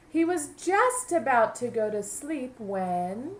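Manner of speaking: lively picture-book narration; storybook voice for young listeners; reading to children. A narrator's reading. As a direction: read it aloud to a class of kids.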